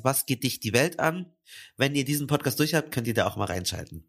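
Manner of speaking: ordinary talk, nothing marked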